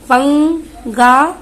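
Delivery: 'पंगा' slow, syllable by syllable, rising at the end; slow syllable reading. Shown as a demonstration. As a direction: sound out पंगा for the class. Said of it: "अब यहाँ पर".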